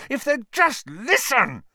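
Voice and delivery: high exasperated outburst; furious. Acted. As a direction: burst out in frustration. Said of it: "Harry said furiously".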